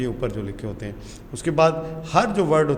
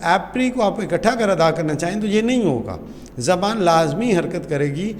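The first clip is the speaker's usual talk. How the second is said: normal talking voice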